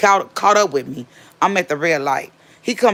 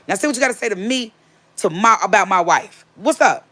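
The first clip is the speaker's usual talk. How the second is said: confrontational challenge; 5 semitones higher than usual. Retold or imitated. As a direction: square up and dare him to talk. New husband confronting the ex.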